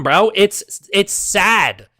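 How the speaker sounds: usual speaking voice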